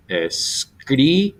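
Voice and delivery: escríbelo slowly, syllable by syllable, leaning on CRÍ; stress on third-last syllable. Shown as a demonstration. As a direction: draw out and punch the CRÍ in escríbelo. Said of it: "it's gonna be three syllables back".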